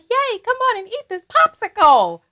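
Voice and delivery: high-pitched gleeful cheer; happy voice. Acted. Food voice luring someone to a popsicle, happy and high. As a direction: invite them brightly, way up high in pitch. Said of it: "Is it a happy voice?".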